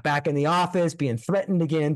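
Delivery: ordinary delivery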